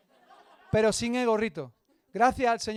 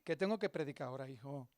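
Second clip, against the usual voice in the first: much softer, low muttered plea; whisper-like, so nobody hears; hushed aside; 7 semitones lower, 10 dB quieter than usual. Retold or imitated. Pastor quietly begs the man to stop before he preaches, hushed.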